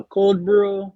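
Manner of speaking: put-on a playful take on a friend's British-tinged Zambian accent. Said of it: "He had this, like, British, you know, accent".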